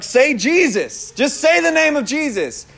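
acted urging. Acts urging friend on phone.

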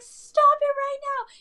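high, slow, thrilled disbelief; friend's thrilled squeal. Retold or imitated. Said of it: "And she's like".

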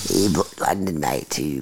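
very low, soft mock Australian; Aussie accent. Riffing to a friend, low mock Australian accent. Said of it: "I do love the accent".

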